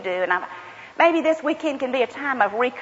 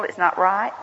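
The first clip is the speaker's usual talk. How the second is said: usual speaking voice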